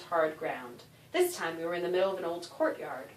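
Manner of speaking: plain reading voice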